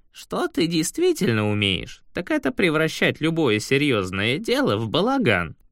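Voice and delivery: said aloud by Shurf. Acted. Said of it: "вслух сказал Шурф".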